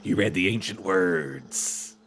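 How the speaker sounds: slow, low dummy voice; raspy dummy voice. A narrator's reading. Reading the dummy's lines to co-host, slow and low. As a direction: drag out each word like a creepy dummy. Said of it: "in his raspy voice".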